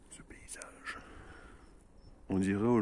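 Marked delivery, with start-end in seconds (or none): 0.1-1.0 soft breathy whisper; whispered echo. Acted. Gamer whispering to viewers about the landscape. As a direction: hush the words in awe.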